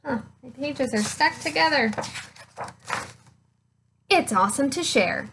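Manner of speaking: ordinary delivery, nothing marked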